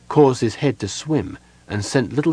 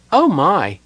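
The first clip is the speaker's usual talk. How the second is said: high, louder startled little exclamation; taken aback; 8 semitones higher, 5 dB louder than usual. A narrator's reading. Kender facing a sea of raised hands, taken aback. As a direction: exclaim as if caught off guard. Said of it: "clearly taken aback".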